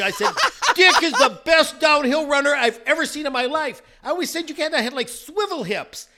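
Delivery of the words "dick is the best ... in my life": loud, raised, excited boast; re-enacting an excited shout; retold yelling. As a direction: yell it with total conviction. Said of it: "I started yelling".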